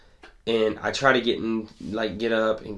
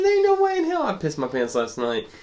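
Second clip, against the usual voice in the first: high, loud retold protest, half laughing; mock outraged denial to mom; indignant denial; 10 semitones higher, 4 dB louder than usual. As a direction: insist you never did it, voice jumping up. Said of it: "I said".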